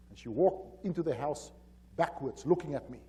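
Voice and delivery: ordinary talk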